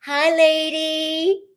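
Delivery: high, drawn-out, loud hello; singsong greeting. Real, not acted. Host hails a lady joining the chat, singsong.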